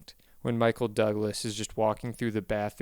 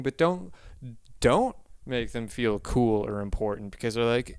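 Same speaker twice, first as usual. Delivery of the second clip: ordinary talk, nothing marked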